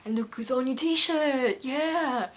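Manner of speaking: put-on voice, cheering at a fan's shirt. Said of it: "And he goes".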